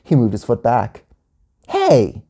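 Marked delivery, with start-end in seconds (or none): 1.7-2.2 short, higher yell; quick protesting shout. A narrator's reading. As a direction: yell to get attention. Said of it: "yelled Gia".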